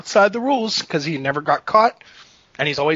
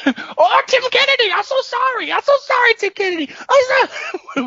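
Yoel Romero impression. Fighter begs his opponent's pardon, squeaky mock accent. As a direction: apologize in a squeaky, over-the-top accent. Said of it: "Yoel Romero was like".

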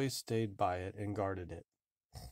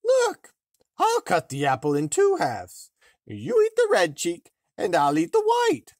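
put-on disguised old woman; 8 semitones higher, 10 dB louder than usual. A narrator's reading. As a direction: croak it sweetly as a disguised hag. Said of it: "said the old woman".

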